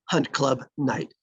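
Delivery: ordinary talk, nothing marked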